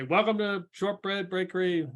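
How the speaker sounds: high, sing-out bakery greeting; called-out shop welcome. Acted. Baker greets customers coming in, calling out. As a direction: call a welcome across the shop.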